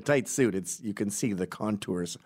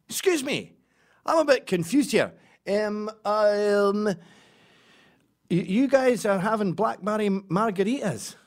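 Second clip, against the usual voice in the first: much higher, louder Scottish voice, slow; Scottish tourist; 11 semitones higher, 6 dB louder, slower than usual. Pretend. To the margarita drinkers at the airport, high Scottish accent. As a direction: fake Scot feigning confusion. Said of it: "So I go up to the margarita guys".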